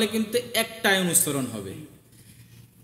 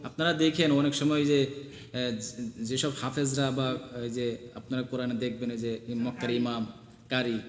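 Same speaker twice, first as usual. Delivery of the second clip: quiet aside; 5 semitones lower, 7 dB quieter than usual. Real, not acted. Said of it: "quiet aside".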